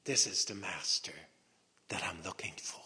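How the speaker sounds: hushed whisper; whispered realization. Acted. Mary realizing her master is here, whispered. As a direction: whisper it in awe.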